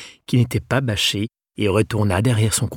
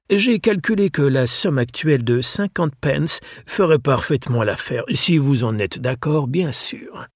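voix plus aiguë de vieux gentleman, affable; vieux libraire anglais accueillant; 5 semitones higher than usual. Acted. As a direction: accueillir avec chaleur et cérémonie. (le libraire accueille Mathias, aimable et cérémonieux)

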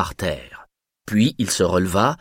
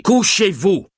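high, louder cutting-off shout; shouted command; 10 semitones higher, 4 dB louder, slower than usual. A narrator's reading. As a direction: cut him short with a shout. Guard cuts off the old man.

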